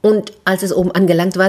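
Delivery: usual speaking voice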